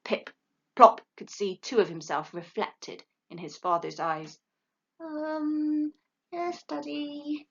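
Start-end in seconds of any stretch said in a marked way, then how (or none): 5.0-7.5 high, slow, meek little voice; timid owlet. A narrator's reading. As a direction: shrink back.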